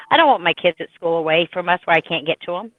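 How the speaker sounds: usual speaking voice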